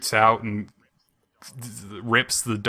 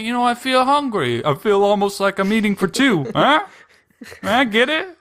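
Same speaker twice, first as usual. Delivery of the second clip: put-on cheeky punning boy; 11 semitones higher, 6 dB louder than usual. Son joking, voiced.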